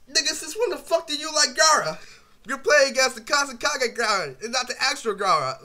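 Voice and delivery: loud, high mocking shout; mocking yell. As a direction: yell it like a teasing friend calling him out. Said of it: "we'd be like".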